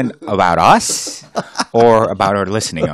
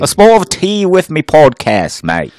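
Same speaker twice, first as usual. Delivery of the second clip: hammed-up British accent, higher and louder; mock British accent; 7 semitones higher, 6 dB louder than usual. A podcaster playing a Brit for the UK fans, mock British accent. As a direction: ham up a British accent for the UK listeners. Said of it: "It's our accents".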